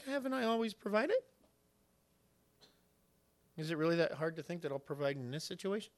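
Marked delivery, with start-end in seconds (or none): 0.0-1.2 God's reply in a raised pitch; imitated gentle rebuke. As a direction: answer the complaint with a high, pointed question. Voices God's reply to complaint.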